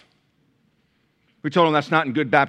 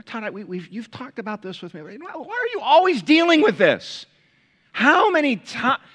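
exasperated Jesus; 9 semitones higher, 5 dB louder than usual. Pretend. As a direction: build from muttering to exasperated outburst. Pastor voices an exasperated Jesus.

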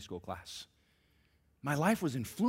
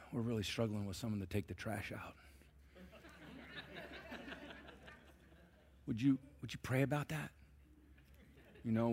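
mock gentle dad; 2 semitones lower, 7 dB quieter than usual. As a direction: ham up a dad who begs timidly. Dad voiced as pleading gently.

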